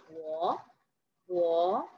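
"guo" drawn out and rising; second tone. Shown as a demonstration. Teacher drills the pronunciation for learners, "guo" in rising second tone. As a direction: repeat "guo" with a slow upward glide. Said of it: "Ini intonasi kedua. Jadi bacanya".

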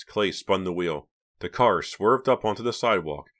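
ordinary delivery

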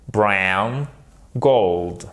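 "brown" and "gold" with gliding vowels; diphthongs. Shown as a demonstration. Teacher's final recap for learners, diphthongs in "brown" and "gold". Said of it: "savoir faire les diphtongues".

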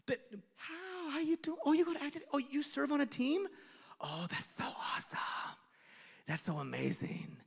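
lightly fake-sweet gossip. Gossip's fake friendliness continues.

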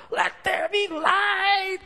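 high, slow booming proclamation; God's booming command; mock shout. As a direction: bellow it out, hammed-up. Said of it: "he had to shout".

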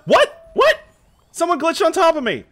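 very high, loud squawk of disbelief; outraged yelling. Real, not acted. Streamer outraged at being glitched on, high disbelieving yell.

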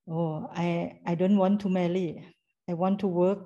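ordinary talk

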